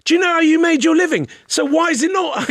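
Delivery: high-pitched mock badgering; indignant challenge. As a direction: imitate an affronted man pressing his point, pitched right up. Intruder repeats his challenge in retelling.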